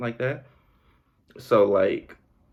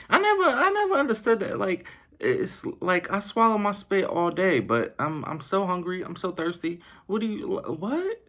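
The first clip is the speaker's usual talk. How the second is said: put-on higher, whiny little child voice; a thirsty kid pleading in a high voice; 10 semitones higher than usual. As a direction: play a five-year-old who is confused. Voicing a thirsty five-year-old.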